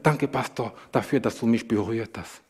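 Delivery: low, hushed quote of a weak man; barely able to speak. Retold or imitated. Dying patient thanks the pastor for his touch, barely able to speak. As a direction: let the thanks sink soft and low. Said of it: "er konnte nicht mal richtig sprechen".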